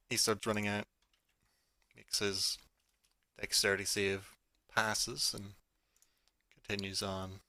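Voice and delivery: ordinary talk, nothing marked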